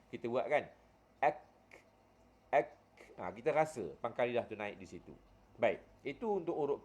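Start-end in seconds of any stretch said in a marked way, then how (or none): 1.2-2.7 mati kaf ek; matikan kaf. Shown as a demonstration. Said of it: "Cuba kita matikan kaf tu". (ek pendek dengan kaf mati)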